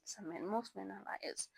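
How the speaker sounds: whispered aside; conspiratorial whisper. Real, not acted. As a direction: whisper it like a naughty secret. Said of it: "I'm just joking".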